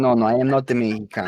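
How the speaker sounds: put-on quick denial in a muddled Mexican accent; Russian-Mexican mock accent. Denying he's Mexican, in a muddled Russian-Mexican accent. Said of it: "trying to do a Mexican".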